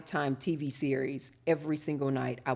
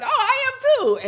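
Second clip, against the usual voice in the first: very high, loud imitated squeal; delighted agreement; 10 dB louder than usual. New acquaintance replies to a hugger, loud delighted squeal. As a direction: gush it at top pitch, mimicking an eager hugger. Voicing people who love hugs too.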